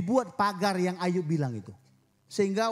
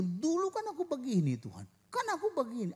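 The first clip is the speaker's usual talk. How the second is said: higher, whining complaint; whiny prayer; 8 semitones higher than usual. Acted. Believer complaining to God in prayer, whining higher. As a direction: plead like a sulking child.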